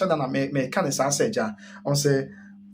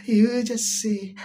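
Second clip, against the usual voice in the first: slow, drawn-out officiant cue; mock pastor prompt; 7 semitones higher, much slower than usual. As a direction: drawl it like a pastor leading vows. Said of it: "The osofo be can be a".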